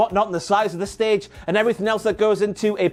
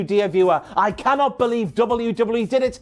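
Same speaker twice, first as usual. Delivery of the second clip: usual speaking voice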